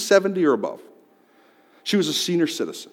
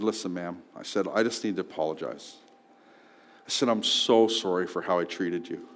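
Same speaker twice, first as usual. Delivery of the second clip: soft, quiet, earnest; sincere apology; 9 semitones lower, 9 dB quieter than usual. Retold or imitated. Man apologizing to the clerk, quiet and sincere. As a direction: say it softly and humbly.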